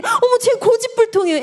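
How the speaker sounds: high-pitched, put-on exasperated mother; complaining parent. Parents griping about their stubborn child, mock exasperation.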